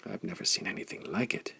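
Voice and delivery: hushed whisper, breath only; awed unvoiced whisper; soft whisper. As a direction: whisper it in awe so no one hears. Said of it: "Bessel grunted softly".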